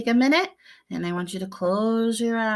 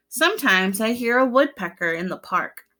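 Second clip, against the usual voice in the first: usual speaking voice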